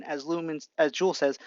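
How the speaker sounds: usual speaking voice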